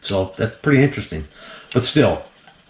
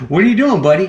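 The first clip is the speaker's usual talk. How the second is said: high, bright greeting to the snake; light, loud greeting to a pet; excited; 8 semitones higher, 3 dB louder, faster than usual. Real, not acted. Talking to his pet cobra.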